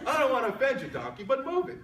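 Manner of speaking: fake-polite then shove him off; worm insisting.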